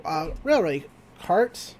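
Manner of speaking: usual speaking voice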